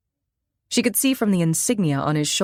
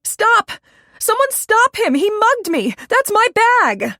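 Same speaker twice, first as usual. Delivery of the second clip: high, loud screams for help after a thief; desperate yell; 6 dB louder, slower than usual. Acted. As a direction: shout after the mugger at the top of your voice. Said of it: "and began to yell".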